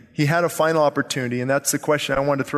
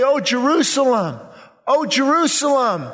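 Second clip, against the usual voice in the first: high, impassioned cry over a city; preacher voicing a grieving plea, without real tears; weeping lament; 8 semitones higher than usual. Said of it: "weeping, Jesus weeping".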